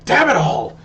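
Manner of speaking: the head chef curses from across the kitchen; head chef exclaiming. Acted. Head chef swears off in the kitchen, a mild mock outburst. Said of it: "you hear the head chef go".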